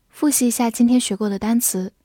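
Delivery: normal talking voice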